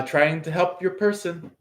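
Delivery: ordinary talk, nothing marked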